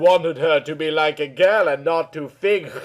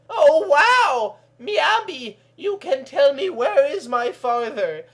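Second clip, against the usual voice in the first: put-on amazed girl; 10 semitones higher than usual. Kelly amazed.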